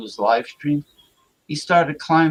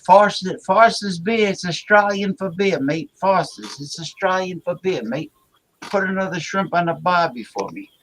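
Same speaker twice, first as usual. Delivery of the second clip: hammed-up, higher-pitched Australian accent; 5 semitones higher, 3 dB louder than usual. Host showing the mate his usual Aussie impression, Australian accent. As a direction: put on a cartoon Aussie beer-ad accent. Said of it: "I do the, uh, the accent".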